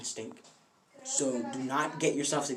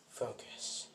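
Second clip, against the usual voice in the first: low, whispered reminder; very soft, inward focus; hushed self-talk; 4 semitones lower, 13 dB quieter than usual. Retold or imitated. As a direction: murmur it to yourself. Deku steadying himself in retold fight.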